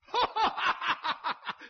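high, loud guffaw; boisterous tough-guy cackle; scornful laugh. A narrator's reading. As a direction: burst of big mocking laughter. Boss laughs at the challenge.

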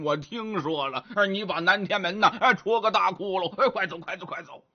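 pig-man voice, grunting between phrases; grunting Zhu Bajie, grumbling. Acted. Bajie asks how they get past the locked door, grunting grumble. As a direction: grumble and snort like a doubting pig.

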